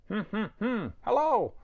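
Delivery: high, bright-eyed puppet character introducing itself; high cartoon puppet hello. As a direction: squeak it out like a cheerful little puppet. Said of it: "My name's question mark".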